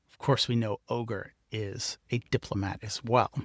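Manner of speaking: ordinary delivery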